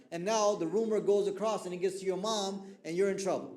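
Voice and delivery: ordinary delivery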